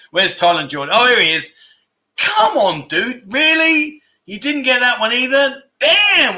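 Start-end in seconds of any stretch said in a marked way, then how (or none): 2.2-6.4 mock disbelief. Teasing viewer who missed answer.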